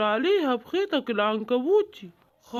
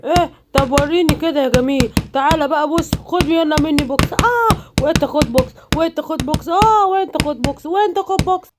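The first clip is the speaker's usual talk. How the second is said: fighting shouts; 3 semitones higher, 7 dB louder than usual. Acted. Hulks trade punches.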